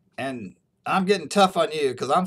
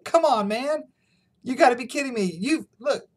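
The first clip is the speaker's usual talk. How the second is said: much higher frantic protest; freaking out; 9 semitones higher than usual. Retold or imitated. Professor protesting to the dean, frantic and much higher. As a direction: imitate the professor in a high panic. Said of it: "freaks out and says".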